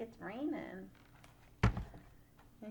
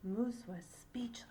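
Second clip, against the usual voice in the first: whispered; 4 dB quieter than usual. A narrator's reading. Reading to grandchildren.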